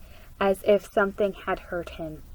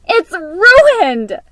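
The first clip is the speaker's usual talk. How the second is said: high, loud anguished cry; 9 semitones higher, 11 dB louder than usual. A narrator's reading. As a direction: shout it in despair. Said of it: "Clara cried out".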